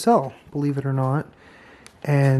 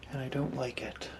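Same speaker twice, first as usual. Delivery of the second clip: much softer, uneasy; hushed worried murmur; nervous night vlog whisper; 10 dB quieter than usual. Real, not acted. Headache in the dark cell.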